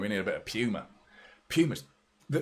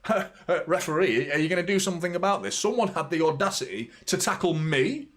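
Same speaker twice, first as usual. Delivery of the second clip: mock outrage, climbing into very high disbelief; indignant complaining star; 7 semitones higher than usual. Mbappe complaining to the referee about a tackle, mock indignation. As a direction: put on an affronted, high-pitched whine of complaint. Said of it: "complaining, looking at his, looking at the referee".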